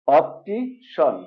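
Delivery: "অপটিশন" slowly, ending in shon; correct SHON ending. Shown as a demonstration. Teacher breaks down the word, "অপটিশন" slowly with shon. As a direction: stretch "অপটিশন" as op-ti, then shon. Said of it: "recap".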